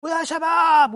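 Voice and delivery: a mocking loud call to the crowd; mock shouted call. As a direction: belt it out like a showy street preacher. Imitates preacher calling out in cafés.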